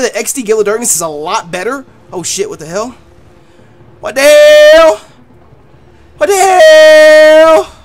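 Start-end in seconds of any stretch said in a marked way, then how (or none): 2.1-3.0 a low, soft what the hell; half under the breath, caught off guard; muttered surprise. Real, not acted. Streamer caught off guard by the cutscene, muttering. 4.0-7.8 long, slow, loud what the hell; drawn-out disbelief. Real, not acted. Streamer stunned by the cutscene, slow loud disbelief.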